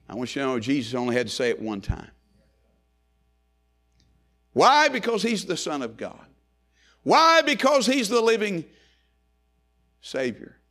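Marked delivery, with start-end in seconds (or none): 4.5-10.6 shouted pulpit question, answered; call and answer preaching. Acted. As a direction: fire the question at the church.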